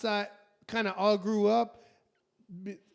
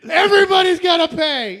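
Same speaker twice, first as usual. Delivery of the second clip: hammed-up furious yell; mock rage; 8 semitones higher, 14 dB louder than usual. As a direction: roar it like an over-the-top action hero out for revenge. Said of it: "steaming, back to Airwolf helicopter".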